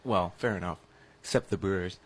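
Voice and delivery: usual speaking voice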